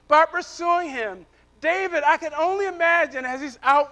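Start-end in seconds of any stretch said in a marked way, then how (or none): none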